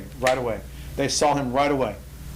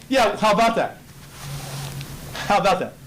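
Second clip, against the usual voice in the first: smug; 5 semitones higher than usual. Acted. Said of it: "It's God's way of saying".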